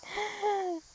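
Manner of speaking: a sudden high giggle that falls away; high squeal of laughter. Real, not acted. Laughing at how greedy she is, a high giggle.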